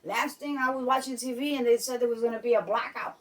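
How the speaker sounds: normal talking voice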